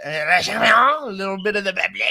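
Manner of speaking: mock French, like Pepe Le Pew; a hammy cartoon French voice; French accent. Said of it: "You sounded like Pepe Le Pew".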